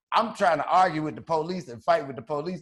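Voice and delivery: normal talking voice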